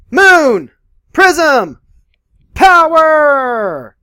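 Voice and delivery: high, loud, bright anime transformation cry; magical girl transformation. Pretend. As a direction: shout it like a magical girl powering up. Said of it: "magical girl transformation".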